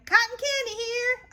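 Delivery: very high echo of the vendor; Eloise echoing. Acted. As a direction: copy the call gleefully. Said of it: "I say".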